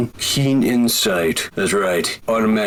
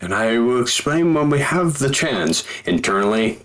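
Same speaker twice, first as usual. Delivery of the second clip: ordinary delivery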